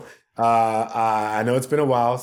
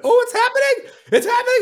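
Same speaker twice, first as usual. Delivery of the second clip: much higher and louder, laughing; thrilled; 7 dB louder than usual. Retold or imitated. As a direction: squeal it with giddy disbelief. Thrilled at Prince's call.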